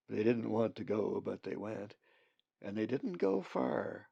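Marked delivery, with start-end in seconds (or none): none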